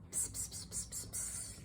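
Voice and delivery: hushed unvoiced psst psst; whispered psst. Lovelock secretly whispering to every umlaut, hushed psst. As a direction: hiss it secretly.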